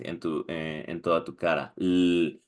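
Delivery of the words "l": "L-" drawn out as a low, voiced hum; voiced L sound. Shown as a demonstration. Teacher proving the sound is voiced, "L-" held steadily. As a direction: hold "L-" and keep it buzzing. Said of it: "es voiced".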